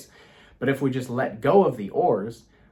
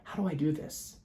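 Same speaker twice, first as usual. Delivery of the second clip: calm plea; 5 semitones higher, 5 dB quieter than usual. Retold or imitated. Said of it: "I calmed my mind and I just said".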